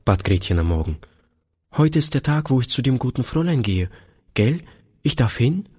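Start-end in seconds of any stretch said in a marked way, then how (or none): 1.8-5.7 put-on higher childlike voice, quick and hopeful; a small girl pleading to be allowed to go; eager little girl. A narrator's reading. Gretchen begging her father to let her go, eager higher child voice.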